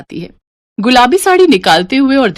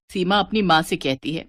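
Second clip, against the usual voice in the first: ordinary delivery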